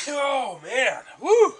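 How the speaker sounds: sudden loud high whoop after a sneeze; loud post-sneeze yelp. Real, not acted. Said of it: "Sorry if I blew out anyone's ears there".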